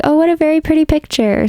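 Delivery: delighted. Retold or imitated. Delighted exclamation at picture.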